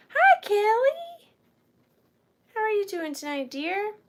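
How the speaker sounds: cheery high-pitched hi; sing-song greeting. Real, not acted. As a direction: greet the buyer in a lilting high voice. Live sale greeting a buyer.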